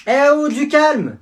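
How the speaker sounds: high, slow loud yell; shout. Acted. Teacher yelling at rowdy class to calm down. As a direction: bellow for calm. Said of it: "M'obligez pas à crier".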